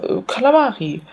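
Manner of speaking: normal talking voice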